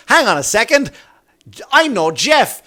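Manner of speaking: high, loud protest to a cop; indignant driver. Acted. Driver stopping a cop writing a ticket, indignant.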